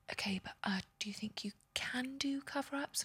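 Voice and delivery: whispered quote of the boss; hushed, breathy retelling of his question; mock whisper of the interviewer; retold whisper.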